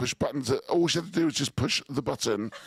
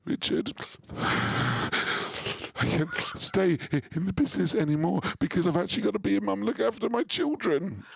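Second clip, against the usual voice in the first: imitating her; 6 semitones higher than usual. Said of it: "it was".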